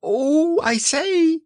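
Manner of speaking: very high agitated squeak; flustered exclamation. A narrator's reading. Piglet jumping up and down, flustered squeal. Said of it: "was jumping up and down and making".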